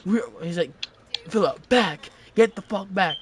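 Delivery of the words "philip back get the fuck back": raised, higher mock command; commanding a dog. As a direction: order him back like a misbehaving dog. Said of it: "talking to him like he was a dog".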